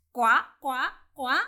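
loud quacking duck; loud duck quacks. Acted. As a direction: quack like a duck calling for help. Duck calls the frog.

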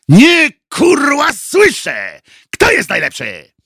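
high, loud, drawn-out opening bellow; drill-sergeant shout. A narrator's reading. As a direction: demand an answer, shouting the first words. Coach demands a louder answer.

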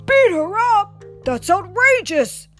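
acted father's shocked indignation; outraged papa.

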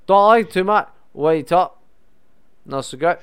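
put-on rough comic Scottish accent, slipping toward Irish. Comedian showing co-host a little Scottish, hammed-up Scottish accent. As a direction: lay on a choppy mock-Scottish brogue. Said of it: "You sound kinda Scottish there".

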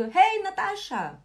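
high, loud friendly hey; greeting friends across the room, bright and loud; cheery greeting. Shown as a demonstration. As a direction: call out hey to a passing friend. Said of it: "Eu vejo a Natasha passar e digo".

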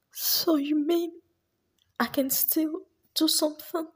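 high, tentative hope; cautiously daring to hope; Precious, hopeful. Acted. Asking her friend if she can still succeed, high and hopeful. Student hears encouragement.

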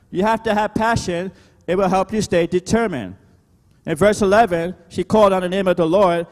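high, driving pulpit delivery; emphatic preacher's rallying call; preaching. Real, not acted. Preaches at full force.

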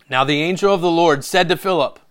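loud, proclaiming Bible reading; declamatory Scripture reading. A narrator's reading. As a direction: proclaim the verse to the church. Reads Scripture aloud.

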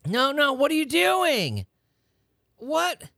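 high, slow incredulous no; incredulous disbelief. Real, not acted. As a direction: react in horrified disbelief. Reacting to Kit Kat video.

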